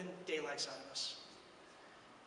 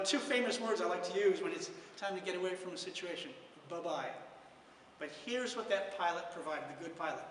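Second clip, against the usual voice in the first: normal talking voice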